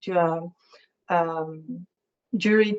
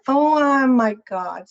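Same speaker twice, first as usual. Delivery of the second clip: louder, higher, drawn-out "oh"; slow, raised cry of dismay; real startled exclamation; 5 semitones higher, 5 dB louder than usual. Real, not acted. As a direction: caught off guard by a slip. Sudden real slip during her talk.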